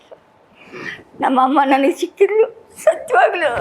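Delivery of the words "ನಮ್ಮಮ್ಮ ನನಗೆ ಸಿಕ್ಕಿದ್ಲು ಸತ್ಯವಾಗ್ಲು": real tears as she speaks of her mother; crying. Real, not acted. Elderly grandmother telling the reporter about her mother, crying. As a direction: voice breaking into tears, pitch climbing. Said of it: "ಅಳ್ಬೇಡಿ".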